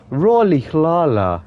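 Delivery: Rolly Lala slow, smooth, Lala drawn out; imitating the translator. Streamer copying Google Translate's pronunciation, slowly. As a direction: copy the machine's tune. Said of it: "They go".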